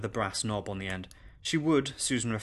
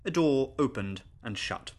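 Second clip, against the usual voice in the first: ordinary delivery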